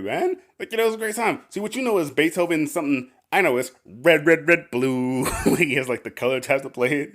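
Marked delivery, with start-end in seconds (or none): 0.0-5.2 carefree Andy. Retold or imitated. Andy answering yelling Kevin.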